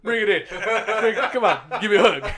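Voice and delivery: a mock-heroic pet offering a hug, laughing; hamster comforting.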